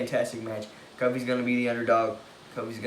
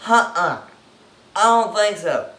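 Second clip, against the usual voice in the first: emphatic refusal; 6 semitones higher, 10 dB louder than usual. Acted. Rejecting the choice emphatically.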